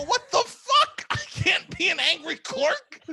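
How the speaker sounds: high, drawn-out mock disbelief; hammed-up squeal of protest; incredulous outburst. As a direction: exclaim it way up high, stretching the words. Acted outburst at friend's part.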